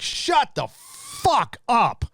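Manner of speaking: drawn out, high and very slow, fed up; exasperated outburst. Real, not acted. Streamer fed up with celebrity Oscar speeches, drawn-out exasperation. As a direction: stretch every word like you've had enough.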